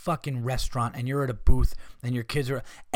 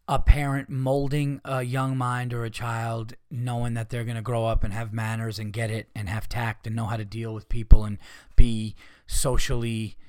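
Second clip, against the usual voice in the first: ordinary talk, nothing marked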